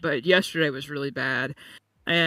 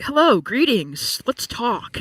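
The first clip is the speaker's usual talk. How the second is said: higher, slow, chirpy, overly friendly hello; mock cheery greeting; 7 semitones higher, 3 dB louder, slower than usual. Mocking people who want to chat when she's in pain, higher and slow. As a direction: play the annoying acquaintance who wants to chat, sweetly and slowly. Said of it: "they're like".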